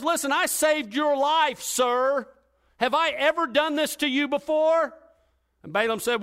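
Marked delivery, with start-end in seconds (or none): 0.0-4.9 donkey pleading. Acted.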